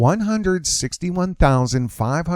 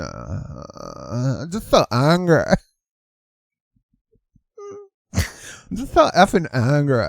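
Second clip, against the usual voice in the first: mock angry, cracking up; slower than usual. Commentator mocking a protester, cracking up mid-line. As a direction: play the angry protester until the laugh takes over. Parody, breaks into laughing cry.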